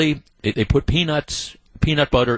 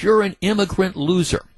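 higher, sneering retold insult; mock yell; 7 semitones higher than usual. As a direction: toss the insult up high, half-acted. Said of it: "in the scheme of screaming at these people".

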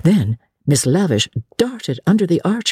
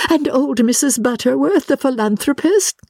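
high, effusive questioning; eager society lady; 11 semitones higher than usual. Acted. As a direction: ask eagerly in a high, ladylike voice. Miss Lavish asks eagerly.

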